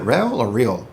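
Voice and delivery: "Rael" against "Real", weighing both readings; unsure name check, "Rael" or "Real"; two pronunciations compared. Shown as a demonstration. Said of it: "I believe I'm pronouncing her name correctly".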